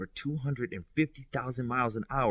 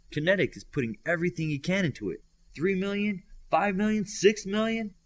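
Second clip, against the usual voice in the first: rising excitement; 8 semitones higher than usual. A narrator's reading. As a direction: call out each number more thrilled than the last. Relaying Kinetic's climbing speed excitedly.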